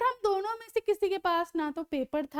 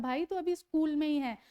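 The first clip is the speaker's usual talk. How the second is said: normal talking voice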